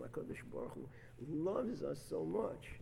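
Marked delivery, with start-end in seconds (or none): 0.0-0.9 hushed, breathy whisper of God's name. Real, not acted. Preacher hushing as he names God, whispered. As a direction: whisper it in awe. Awed aside about God's love.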